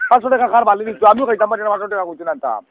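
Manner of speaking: ordinary talk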